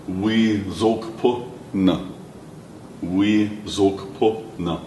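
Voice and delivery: "We zok na" said very slowly. Shown as a demonstration. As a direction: spell it out slowly for learners. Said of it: "for example".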